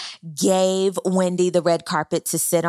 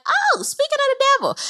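much higher, exaggerated group reaction; mock greeting. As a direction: sing out a high-pitched mock hello. Voicing everybody greeting Candace's arrival.

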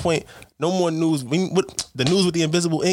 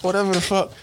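ordinary delivery

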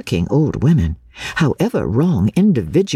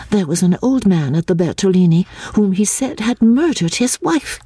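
lightly voiced higher-pitched Lucy, indignant; earnest young Lucy, disapproving; 7 semitones higher than usual. A narrator's reading. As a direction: complain earnestly in a raised pitch. Lucy's speech in the story.

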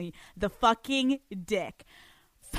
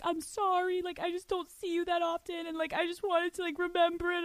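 mimicking a crying boyfriend in a much higher, whiny voice; whiny apology; 9 semitones higher than usual. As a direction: imitate him whimpering his excuse. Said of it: "And he started crying".

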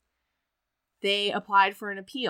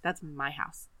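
low, quiet, cold refusal; imitating a wife who has stopped crying; tears switched off; 7 semitones lower, 6 dB quieter than usual. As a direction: drop your voice and stake your claim. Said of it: "She stops crying".

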